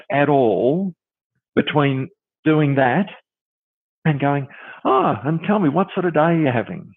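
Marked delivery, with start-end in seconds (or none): 4.8-6.9 high, singsong chatty dentist; hammed-up friendly chit-chat with swooping pitch; mock small talk. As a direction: make idle chat, overdone and musical. Mocking pointless small talk with patients.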